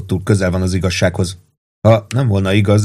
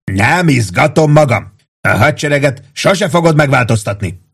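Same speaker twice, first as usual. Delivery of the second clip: agitated narrated yell, pitched higher; 6 semitones higher than usual. A narrator's reading. Pete yelling at Warden about the army, agitated. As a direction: protest hotly in a raised, higher voice. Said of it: "üvöltötte Pete".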